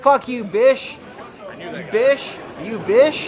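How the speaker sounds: loud mocking "Bish" for bitch; missing T. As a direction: punch "Bish", ending on sh with no T. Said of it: "pronunciate the T".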